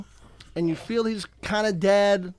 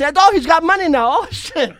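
excited; 8 semitones higher, 6 dB louder than usual. Retold or imitated. Acting out Johnny's excitement.

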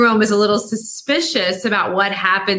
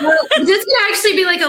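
fast, high, louder words through a laugh; 11 semitones higher, 4 dB louder, much faster than usual. Real, not acted. Host laughing while cheering the author on, fast and high. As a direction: rush it out laughing.

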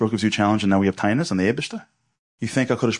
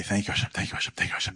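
unvoiced, softer repeated thank you; whispered thanks; 8 dB quieter, faster than usual. Speaker thanking God all day, whispered. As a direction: murmur it over and over under your breath.

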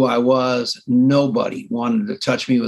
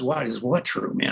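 puzzled calm guy; 3 semitones lower, 6 dB quieter than usual. Retold or imitated. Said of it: "he's a, like a normal human being".

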